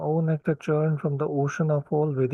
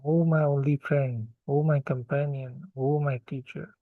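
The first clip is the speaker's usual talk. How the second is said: ordinary talk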